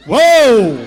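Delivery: loud startled whoa; shocked whoa. Retold or imitated. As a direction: jolt back, stunned. Shocked reaction.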